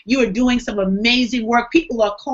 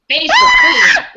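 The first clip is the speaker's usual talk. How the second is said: playful mimic of Precious's accent, shouted much higher and louder; imitating her accent; 8 dB louder, slower than usual. Cohost teasingly echoing Precious's line, shouted in a mock imitation of her accent. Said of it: "but I just love that accent".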